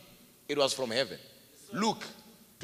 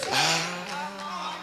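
ordinary delivery

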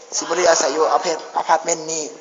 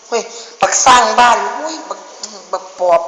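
frightened yell; 4 semitones higher, 8 dB louder than usual. Real, not acted. Screams at the ghoul.

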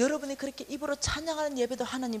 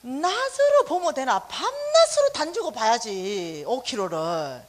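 put-on a sister scolding her older sister, loud and teasing; bossy younger sister; 8 dB louder than usual. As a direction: order her sister around with mock scorn. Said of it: "우리 둘째가 이렇게 말하는 거야".

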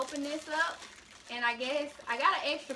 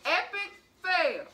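laughing through the words; giggling while talking; 4 semitones higher, 5 dB louder, slower than usual. Real, not acted. Laughing after losing.

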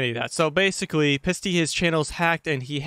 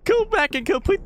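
whiny; 13 semitones higher than usual. Real, not acted. After jump scare.

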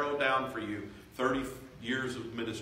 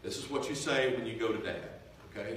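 usual speaking voice

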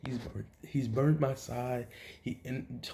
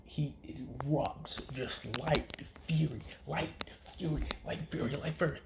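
dramatic whisper; 3 semitones higher, 5 dB quieter than usual. A narrator's reading. Dramatic narration of the eruption.

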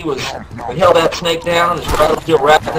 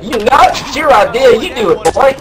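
higher, louder refusal, a touch mocking; indignant refusal; 9 semitones higher, 4 dB louder than usual. Refusing to catch the snake for Keith, indignant raised voice. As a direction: push the dare right back. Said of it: "I was like".

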